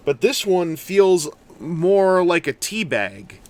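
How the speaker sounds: ordinary talk, nothing marked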